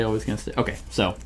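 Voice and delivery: usual speaking voice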